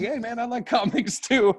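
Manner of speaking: put-on high, excited kid voice; imitating a buddy's keen confession; eager new friend. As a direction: pipe up high, delighted to share the secret.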